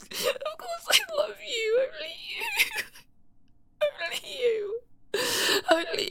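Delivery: high, teary half-laugh; desperate laughing sob; laughing through tears. Acted. Laugh-crying insisting love.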